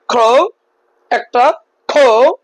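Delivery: slow beats crow then খো; counting syllables. Shown as a demonstration. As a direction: count the parts slowly. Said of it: "বলো তো". (ধীরে syllable গুনে)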